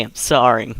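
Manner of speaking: usual speaking voice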